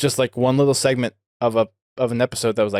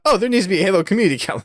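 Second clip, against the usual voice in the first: fast, high, teasing imitation of a sudden idea; mock inner voice; 8 semitones higher, much faster than usual. Host teasing guest about his Garfield idea, quick mock voice. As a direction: rattle it off high like a silly eureka. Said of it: "you just thought".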